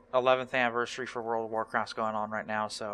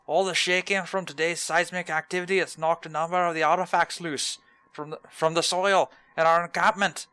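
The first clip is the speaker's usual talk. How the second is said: higher, lively put-on dwarf voice; eager dwarf quest giver; 5 semitones higher, 5 dB louder than usual. Streamer voicing dwarf archaeologist offering a quest, eager put-on voice. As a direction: pitch the dig like an excited old dwarf. Reads archaeologist NPC lines.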